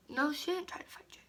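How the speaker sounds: quick whispered aside to herself; whispered correction. Real, not acted. Correcting her own story in a quick whisper.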